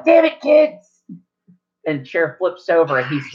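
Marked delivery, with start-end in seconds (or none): 0.0-1.0 put-on high cranky old-man shout; angry grandpa yell. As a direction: bark at the kids as a grumpy grandpa. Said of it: "you hear him yell".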